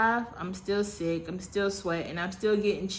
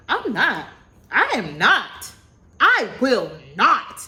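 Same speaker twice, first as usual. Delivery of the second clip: defiant refusal; 3 semitones higher, 8 dB louder, slower than usual. Retold or imitated. Refusing, arguing with the Lord.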